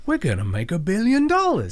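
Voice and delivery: a touch of hammed-up studio boasting; mock greedy excitement. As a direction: crow like a studio exec counting money. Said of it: "So they just go".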